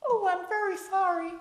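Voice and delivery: high, loud, badly faked American accent. Woman apologising to Alfred, badly faked American. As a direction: botch an American accent in falsetto. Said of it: "That isn't even American".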